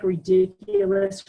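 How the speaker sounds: "ridiculous" drawn out high and slow; emphatic ridiculous. Real, not acted. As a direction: lean hard into "ridiculous", drawing it out high. Listing traumatic experiences emphatically.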